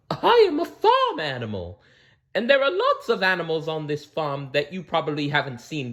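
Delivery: high, friendly farm cow voice. Acted. The cow tells Blinky about the farm, high voice. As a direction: speak up high as a kindly cow.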